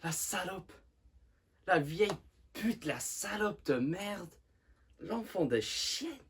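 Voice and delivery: high, loud burst of rage; enraged. Acted. Simone rages about his dead mother after the will, furious. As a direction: spit the insults out in a high, furious voice. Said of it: "exprime sa rage et sa frustration".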